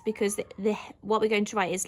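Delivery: usual speaking voice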